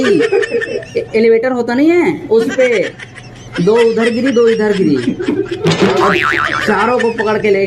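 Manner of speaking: put-on loud show-off boasting to baby over the phone; hammed-up boast, pitched to impress; loud bragging phone call. Bragging to his girlfriend about fainting girls, loud and boastful.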